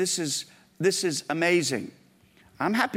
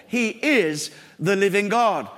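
"is" held and leaned on, slow; slow down and land hard on "is"; He IS the living God, slow and weighty; stress on is; slower than usual. Shown as a demonstration. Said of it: "repeats, slower and louder".

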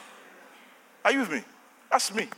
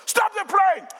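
high, sudden call; retold demand; 13 semitones higher, 4 dB louder, slower than usual. Passenger whose mission money is aboard, high urgent demand. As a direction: burst back into the insistent order. Re-enacting demand to halt plane.

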